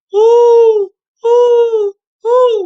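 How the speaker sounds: high, loud hooting whoo; owl hoot. Acted. An owl calls outside the mouse house, loud hooting. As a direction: call out like an owl in the dark.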